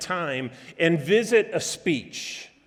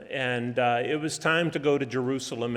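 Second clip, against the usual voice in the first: ordinary talk, nothing marked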